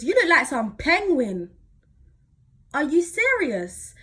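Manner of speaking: loud teasing imitation of dad. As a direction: poke fun at her loudly. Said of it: "My dad goes".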